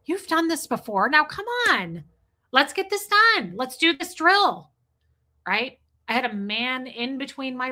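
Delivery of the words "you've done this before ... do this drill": pushy instructor, pitched high and rising; bossy supervisor. Retold or imitated. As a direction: lean over and press the kid to get on with it. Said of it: "the supervisor was bent over me going".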